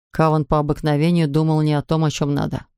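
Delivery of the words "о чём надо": plain reading voice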